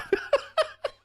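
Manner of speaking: squeaky high burst of laughter; a sudden high giggle. Real, not acted. Laughing at a viewer comment.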